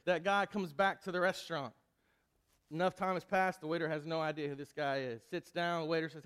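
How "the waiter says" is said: ordinary talk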